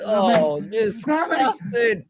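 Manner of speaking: slow, high, disgusted drawl; drawn-out disgust. Real, not acted. Streamer reacting to the clip, slow disgusted drawl. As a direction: stretch out the disgust.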